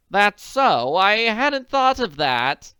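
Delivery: worried wealthy woman; anxious society lady. Acted. Willoughby voice.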